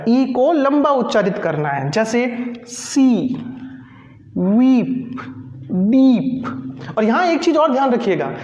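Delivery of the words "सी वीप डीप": सी, वीप, डीप with a long ee; long ee words. Shown as a demonstration. Teacher modelling long ee in सी, वीप, डीप. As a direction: stretch the ee in डीप slowly. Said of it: "लंबा उच्चारित किया जाएगा".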